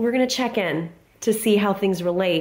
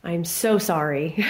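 normal talking voice

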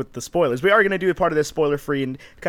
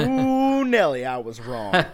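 hammed-up amazement at being so mistaken; comic exclamation; 2 semitones higher, much slower than usual. Real, not acted. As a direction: whoop the Ooh, then sink into a slow confession. Comically owning up to failed predictions.

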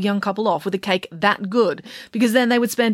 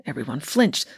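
usual speaking voice

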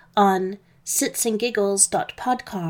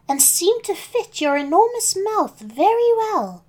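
put-on higher-pitched little girl character voice; Little Red, confused but chatty; 6 semitones higher than usual. Little Red questioning fake Granny, high and puzzled.

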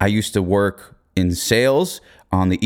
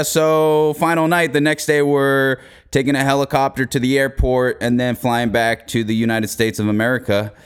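usual speaking voice

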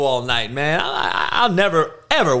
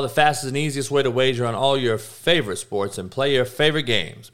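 brisk sponsor read for the betting site; upbeat promo voice pushing the promo code; 3 semitones lower than usual. Acted. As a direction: sell it like a radio spot. Host reads sponsor ad.

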